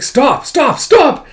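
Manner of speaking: high, loud cries that climb; acted panicked screaming; desperate shouting. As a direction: cry out to halt it, frantic. Alma as stake is pulled out.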